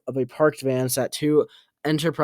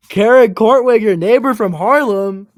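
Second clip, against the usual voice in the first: much higher and louder, calling out her name; loud eager call; 8 semitones higher, 10 dB louder than usual. A narrator's reading. Woman reintroduces herself to Anton.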